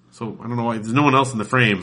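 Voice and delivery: ordinary talk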